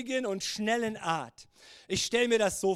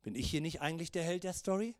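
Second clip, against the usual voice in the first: low, soft muttered objection; quietly miffed, acted; puzzled inner voice; 7 semitones lower, 6 dB quieter than usual. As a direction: play a sidelined hero thinking aloud. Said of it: "und denkt sich".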